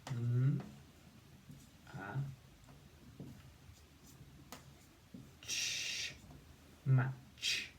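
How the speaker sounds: segmented M, a, ch, match with pauses; sounding out. Shown as a demonstration. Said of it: "let's see".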